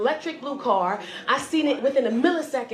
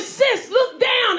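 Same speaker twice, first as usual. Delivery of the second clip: slow, high, loud command; exasperated order; 11 semitones higher, 5 dB louder, much slower than usual. Acted. Rescuer directs trapped sis to the button, exasperated order. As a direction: spell it out in mock impatience.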